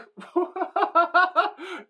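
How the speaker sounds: high burst of laughter; real high-pitched laughing fit. Real, not acted. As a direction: crack up mid-sentence. Breaks up mid-story.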